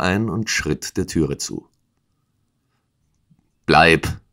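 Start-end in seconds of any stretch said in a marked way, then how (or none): 3.6-4.2 kurzer, lauter Ausruf; gerufen. A narrator's reading. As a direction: ein knapper Zuruf, mit etwas Nachdruck. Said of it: "rief er". (kurz und laut gerufen)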